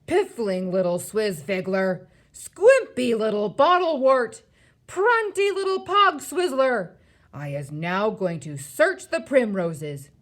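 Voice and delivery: loud, climbing giant tirade; bellowing giant name-calling; shouted insults. A narrator's reading. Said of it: "he shouted".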